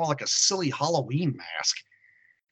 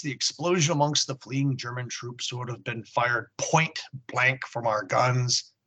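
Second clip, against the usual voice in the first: normal talking voice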